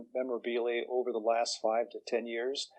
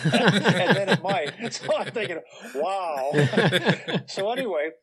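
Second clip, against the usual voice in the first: laughing; 7 semitones higher, 8 dB louder than usual. Real, not acted. Laughing at his own punchline.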